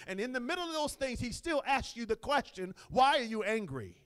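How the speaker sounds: loud, driving preacher's delivery; emphatic pulpit proclamation; full-force preaching. Real, not acted.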